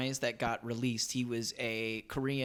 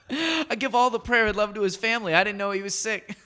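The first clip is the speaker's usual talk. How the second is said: raised, louder imitation of Rodman; mock Dennis Rodman; 6 semitones higher, 5 dB louder than usual. S0 imitating Dennis Rodman's response, raised and gushing. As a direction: gush like an earnest Rodman. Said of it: "Dennis Rodman goes".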